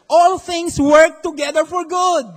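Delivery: loud, slow proclamation; preaching shout. Real, not acted. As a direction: shout it out to the congregation. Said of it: "preaching climax".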